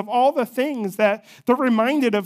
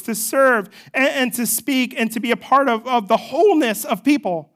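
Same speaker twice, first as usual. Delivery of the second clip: rising, louder preaching build; 3 semitones higher, 4 dB louder than usual. Acted. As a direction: preach it with growing force.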